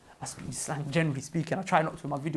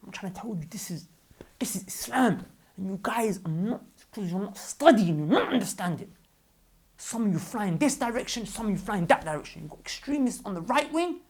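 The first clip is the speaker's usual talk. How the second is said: heated, raised-voice rebuke; upset, loud and higher-pitched; annoyed; 5 semitones higher, 6 dB louder than usual. Real, not acted. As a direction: scold them with rising exasperation. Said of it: "I'm getting annoyed".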